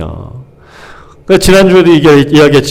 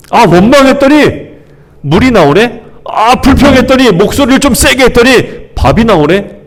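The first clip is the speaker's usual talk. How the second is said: smug grumbler; 7 semitones higher than usual. Retold or imitated. Preacher voices the smug people.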